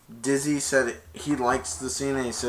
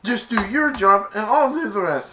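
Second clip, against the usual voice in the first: higher, louder acted command; insistent order; 9 semitones higher, 7 dB louder than usual. Player to teammate mid-game, insistent raised voice.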